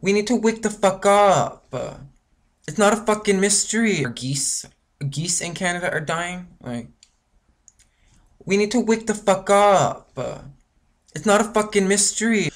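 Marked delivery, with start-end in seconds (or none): 0.0-4.0 high, loud, furious demand; angry shout. Real, not acted. Angry rant about dying animals. 8.4-12.5 high, loud, furious demand; angry shout. Real, not acted. Angry rant about dying animals.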